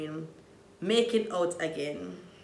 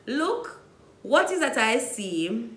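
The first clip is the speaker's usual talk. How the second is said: normal talking voice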